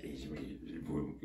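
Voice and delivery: normal talking voice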